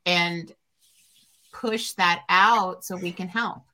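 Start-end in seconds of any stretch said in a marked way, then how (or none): none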